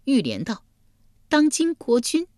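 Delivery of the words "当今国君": high female character voice; plaintive, high-voiced wife; accused woman. A narrator's reading. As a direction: answer the judge in a raised pitch. Woman answers the king.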